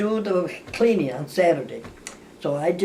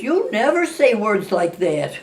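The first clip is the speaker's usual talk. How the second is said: mock scolding sister; 5 semitones higher, 7 dB louder, slower than usual. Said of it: "she says".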